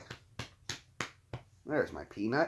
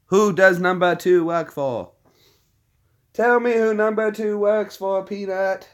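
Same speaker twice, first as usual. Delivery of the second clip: high, louder teasing sing-song; playful sing-song quiz; 6 semitones higher, 4 dB louder, slower than usual. Real, not acted. Owner quizzing her dogs about number two, high playful sing-song. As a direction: egg the dogs on with a teasing lilt.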